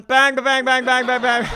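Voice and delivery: rapid, loud, high volley of bangs; shouted gunfire imitation. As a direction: fire off the bangs like a barrage.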